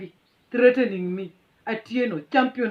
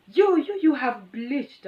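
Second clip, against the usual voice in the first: mocking driver; 5 semitones higher, 4 dB louder, slower than usual. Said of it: "then he says".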